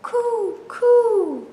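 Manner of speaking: high cooing dove. Shown as a demonstration. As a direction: coo like a dove. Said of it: "Can you coo like the doves".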